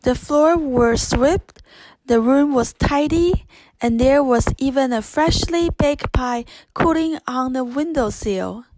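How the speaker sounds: ordinary delivery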